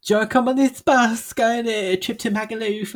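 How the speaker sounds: put-on high, lightly Essex-accented offer; mock Essex. Friend imitating an Essex local's invitation, high and chirpy. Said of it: "someone from Essex came up to me and was like".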